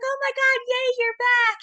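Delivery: high squealing mock delight; excited welcome. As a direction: gush over her coming back. Law firms greeting her return.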